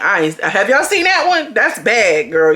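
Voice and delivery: ordinary delivery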